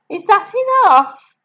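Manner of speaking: put-on little girl voice. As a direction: ask like a small eager girl. Matilda voiced.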